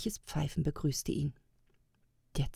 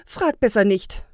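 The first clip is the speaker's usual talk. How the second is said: lightly amused, louder brush-off; slight laugh; 4 semitones higher, 8 dB louder than usual. A narrator's reading. Woman elf waving off his question, lightly amused. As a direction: wave it off with a touch of amusement. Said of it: "meinte sie nur leicht lachend".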